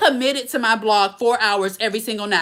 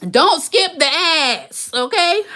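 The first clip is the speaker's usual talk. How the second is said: slow, louder, higher insistence; emphatic reminder; 7 semitones higher, 4 dB louder, slower than usual. Real, not acted. Telling live viewers not to skip the ads, slow and insistent. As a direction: lean on each word slowly. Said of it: "telling viewers not to skip ads".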